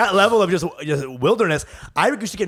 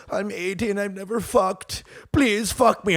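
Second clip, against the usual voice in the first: mock pitiful plea; 4 semitones higher, 3 dB quieter, slower than usual. Old-looking boy pleading with nurses.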